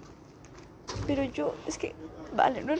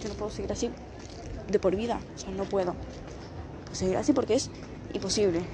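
low, despairing; 6 semitones lower, 4 dB quieter, faster than usual. Real, not acted. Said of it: "y así lo digo".

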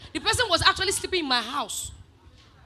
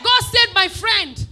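slow, loud, raised declaration; triumphant shout; 5 semitones higher, 12 dB louder, much slower than usual. Real, not acted. Preacher testifying that a friend was saved, loud proclamation. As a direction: proclaim it to the whole room, slowly.

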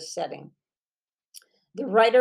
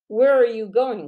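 higher, louder, alarmed; a wife catching him leaving, panicking; panicked question; 4 semitones higher, 7 dB louder than usual. A narrator's reading. Suzanne stopping Frank in alarm, panicked. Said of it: "a note of panic in her voice".

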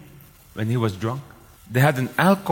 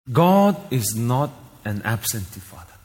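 usual speaking voice

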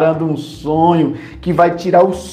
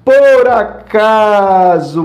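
"por acaso" drawn out slowly, higher; slow emphatic por acaso; 4 semitones higher, 4 dB louder, much slower than usual. Real, not acted. Preacher to the congregation, stretching "por acaso".

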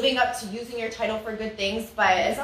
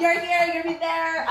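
mock pageant gestures; 6 semitones higher, slower than usual. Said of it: "I know all these hand motions".

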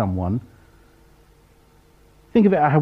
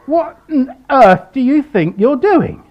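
indignant demand; 10 semitones higher, 6 dB louder than usual. Retold or imitated. Indignant question about roof.

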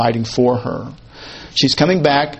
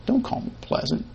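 biting whisper, much softer and higher; snide whisper; 8 semitones higher, 12 dB quieter than usual. Retold or imitated. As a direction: hiss it out, sour and cutting. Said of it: "it's really very snide".

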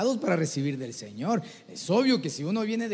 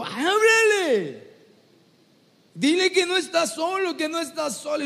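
urgent urging; 9 semitones higher, 3 dB louder than usual. Acted. God urging a hesitant believer to speak, high and insistent. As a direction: urge them loudly to open up and speak.

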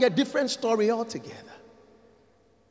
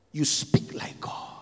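whispered; 7 semitones lower, 7 dB quieter than usual. Acted. Repeated refrain starting in whisper.

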